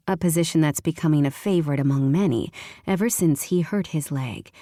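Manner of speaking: ordinary delivery, nothing marked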